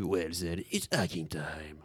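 slow catchphrase. Retold or imitated. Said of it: "des cas d'utilisation concrète de".

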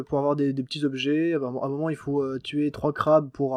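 normal talking voice

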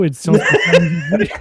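laughing through the words; high-pitched laugh breaking in. Real, not acted. Laughing mid-announcement.

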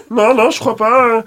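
put-on high, nervous teen caller. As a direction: squeak it out like a shy teen. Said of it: "c'était du genre".

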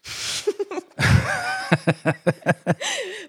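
sudden squealing laugh; high burst of laughter. Real, not acted. Éclat de rire. (éclat de rire aigu)

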